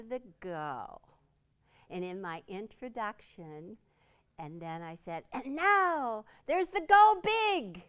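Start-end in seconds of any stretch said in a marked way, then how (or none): 5.3-7.8 high, loud, slow reveal; dramatic reveal. Retold or imitated. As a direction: unveil it like a grand prize. Quoting own book dramatically.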